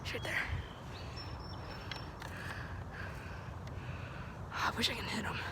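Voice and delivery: breathless unvoiced whisper. Real, not acted. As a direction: hush it, he's close. Hiding near opponent.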